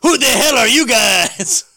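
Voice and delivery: laughing outburst. Real, not acted. Laughing at odd comic panel.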